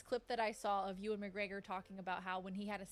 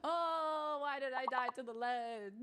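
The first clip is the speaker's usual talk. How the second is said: high, drawn-out whine of regret; mock-anguished wail; 5 semitones higher, slower than usual. Real, not acted. Player lamenting a death at the ledge, wailing. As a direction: wail it slowly, stretching the vowels.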